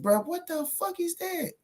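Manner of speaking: very high, slow, soft squeak of disbelief; squeaky disbelief. Real, not acted. Vlogger squeaks in disbelief at Teofimo's excuse. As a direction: pitch it way up in mock shock.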